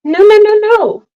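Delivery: high, rising string of protests; imitated refusing child. Mom imitating her child who doesn't want something, high refusal. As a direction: mimic a toddler's shrill little refusal. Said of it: "then she does this when she doesn't want something".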